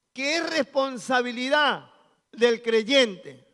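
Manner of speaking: loud, slow sermon climb; full-force preaching. Acted. Pastor preaching holiness to the congregation, loud and fervent. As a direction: preach it out to the whole church.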